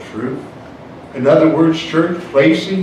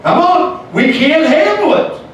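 high, emphatic appeal to the church; urgent preacher's cry; rising preaching; 8 semitones higher than usual. Real, not acted. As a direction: climb in pitch as you press the point. Preaching rises.

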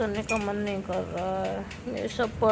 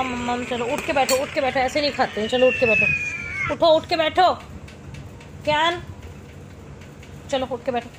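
louder, insistent mother ordering; building loud scolding; firm commands; 4 semitones higher, 8 dB louder than usual. Real, not acted. Ordering child to sit up.

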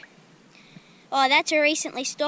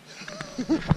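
short bright laugh, high up; delighted laugh. Real, not acted. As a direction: laugh it off after the fish. Fish just got away.

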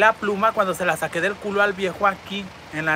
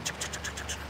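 whispered chuc noise; 15 dB quieter than usual. Retold or imitated. Imitating feather rubbing sound.

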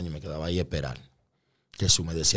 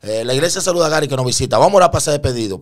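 loud, raised pastor's announcement; pastor addressing congregation; 6 semitones higher, 7 dB louder, faster than usual. Retold or imitated. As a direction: announce it to the whole church. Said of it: "el pastor dijo".

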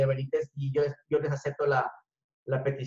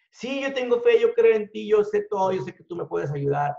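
desperate pleading; 5 semitones higher, 4 dB louder than usual. Retold or imitated. Man begs God for rescue.

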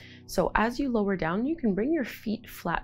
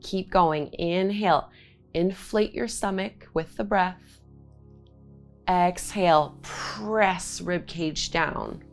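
guided breathing cues. Acted. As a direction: call out each inhale and exhale.

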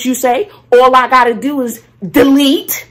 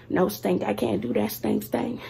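hushed self-talk; 11 dB quieter than usual. Real, not acted. Talking herself out of editing.

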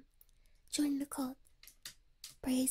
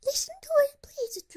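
imitating the manager; 9 dB louder than usual. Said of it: "is what she was saying".

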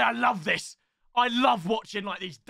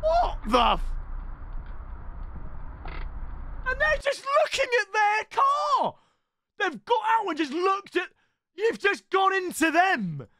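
shocked shouting; 8 semitones higher than usual. Real, not acted. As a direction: shout at the screen in disbelief. Reacting to crash in clip.

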